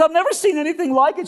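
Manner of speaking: high-pitched overwrought woman; high, worked-up put-on voice; emotional cleaning lady. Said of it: "I'm sorry to be emotional".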